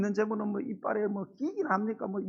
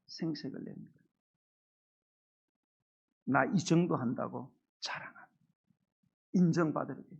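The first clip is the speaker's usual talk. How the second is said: ordinary talk, nothing marked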